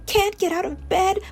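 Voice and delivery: plain reading voice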